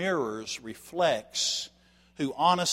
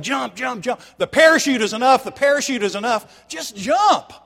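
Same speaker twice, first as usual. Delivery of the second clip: much higher and louder, full-throated shout; pilot yelling; 11 semitones higher, 13 dB louder than usual. Retold or imitated. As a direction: bellow it at the hesitating passengers. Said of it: "this pilot is yelling".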